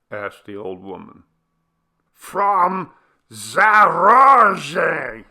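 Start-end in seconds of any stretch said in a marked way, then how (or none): 2.1-5.2 loud high strained yell. A narrator's reading. Villager yelling his village to the old woman. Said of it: "yelled Chebo".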